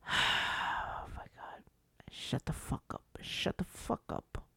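hushed, breathy whisper of disgust; a slow, seething whisper to himself; exasperated whisper. Real, not acted. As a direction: whisper it under your breath, fed up. Fed-up muttering at critics.

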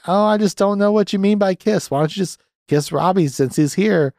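retelling Holly's line in a high mock voice. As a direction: lightly play the coy friend. Voicing Holly in movie recap.

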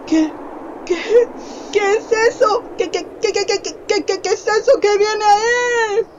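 high, crying, stuttered questions; terrified stammering. Acted. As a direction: stammer in panic at the shape. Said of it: "El terror se apoderó de ellos".

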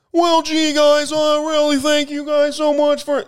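polite, nervous nerd doing a high squeaky thank-you; Rick Moranis voice. Retold or imitated. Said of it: "He's the".

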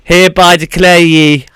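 slow, loud, booming declaration; grand announcer. Acted. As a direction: proclaim the winner like a herald. Host crowns the contest winner.

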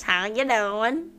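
high, loud, drawn-out mimicry of failed British; mocking bad accent. Mocking a friend's failed British accent, exaggerated. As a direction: parrot a failed English accent, rising at the end. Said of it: "You did not sound English".

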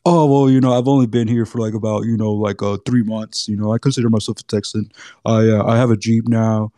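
a hint of a casual out-of-stater bragging; imitating a Texas newcomer. Texan mocking newcomers who claim to be Texan, lightly put-on casual voice. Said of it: "and it's just like".

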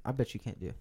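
ordinary talk

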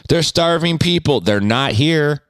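mock guilt-tripper.

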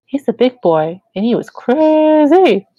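a hint of a motherly church lady; gently sing-song, rising high on crazy; lightly voiced Shirley. A narrator's reading.

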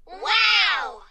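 high, loud, amazed burst; excited exclamation. A narrator's reading. Exclamation Point adding excitement to words, high loud exclamation. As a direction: let the word burst out with wonder. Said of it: "added excitement to words".

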